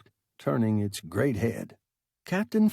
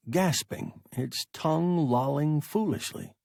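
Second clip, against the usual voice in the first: ordinary delivery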